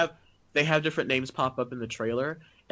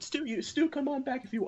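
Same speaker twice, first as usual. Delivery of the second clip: squeaky plea; 12 semitones higher, 6 dB quieter than usual. Retold or imitated. Pleading with Stu, squeaky voice.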